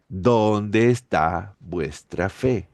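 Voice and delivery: drawn out, each word weighed; slow, searching question to the disciples; slow rebuke. Acted. Jesus slowly asking his fearful disciples, slow grave rebuke.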